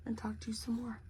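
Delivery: soft playful whisper trailing off; teasing whispered echo. Real, not acted. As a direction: whisper the repeat to yourself. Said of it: "I feel like I could talk to you".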